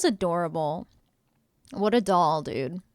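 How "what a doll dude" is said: ordinary talk, nothing marked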